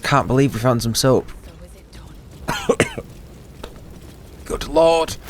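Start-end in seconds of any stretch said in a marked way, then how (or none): 1.4-4.4 high, unvoiced hacking cough; coughing fit. Real, not acted. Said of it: "I'm choking on my own saliva". 4.4-5.2 hoarse after a coughing fit; recovering from choking. Real, not acted. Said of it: "choking on my own saliva".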